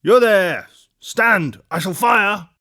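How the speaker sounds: high, shouted warning in short bursts; roared challenge. A narrator's reading. Guard ordering the rider to halt, high shouted challenge. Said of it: "as loud as he could roar".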